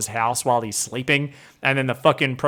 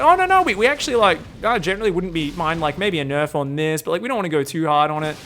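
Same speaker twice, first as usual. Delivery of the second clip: nervous backpedal. Retold or imitated. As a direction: scramble to take it back. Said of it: "They're like".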